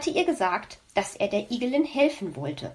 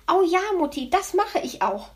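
put-on higher, delighted little boy; beaming child; 8 semitones higher than usual. Hedgehog child agrees with mother, beaming and high. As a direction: cheer it like a thrilled kid. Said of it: "strahlte Borstel".

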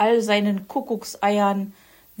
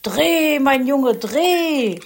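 loud call from below, higher; 6 semitones higher, 7 dB louder, much slower than usual. A narrator's reading. Herr Fuchs calls up to Weißohr, loud call. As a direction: shout it up to the nest.

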